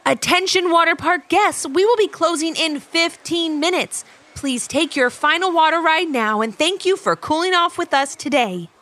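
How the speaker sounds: lightly voiced park announcer; loudspeaker announcement. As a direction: announce it over the PA. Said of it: "an announcement over the loudspeaker".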